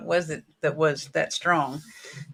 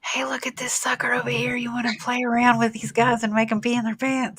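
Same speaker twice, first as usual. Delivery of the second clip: whispered, higher, scheming; mischievous ghost whispering to the others; conspiratorial whisper; 6 semitones higher than usual. Pretend. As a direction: whisper like a prankster spirit. Spirits voiced mockingly.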